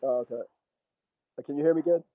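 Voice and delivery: ordinary talk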